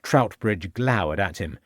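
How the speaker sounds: ordinary delivery, nothing marked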